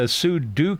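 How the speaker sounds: sudo ends like "do"; sudo as soo-doo. Shown as a demonstration. Naming his preferred camp, sudo said soo-doo. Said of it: "short for super user do".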